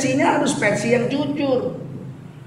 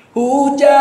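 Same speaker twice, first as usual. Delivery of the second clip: Minang style; 5 semitones higher, 4 dB louder than usual. Shown as a demonstration. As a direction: sing [name] the Minang way, smooth. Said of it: "Lagu Minang tuh baru enak kalau pakai logat Minang".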